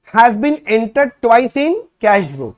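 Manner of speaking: slow, loud, word-by-word reading of the problem; reading the question aloud. Shown as a demonstration. Teacher reading the problem to students, slow and loud. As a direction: dictate each phrase slowly for the class.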